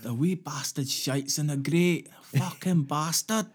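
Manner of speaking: a hammed-up Scottish accent, high and angry; bad Scottish accent, ranting. Podcaster mocking an angry Scot in a bad Scottish accent. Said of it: "I can't do a Scottish accent".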